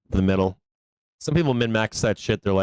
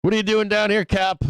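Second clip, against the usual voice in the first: a lightly voiced viewer, pitched higher; teasing viewer voice; 9 semitones higher than usual. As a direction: poke at him like a nosy fan. Voicing a chat viewer teasing.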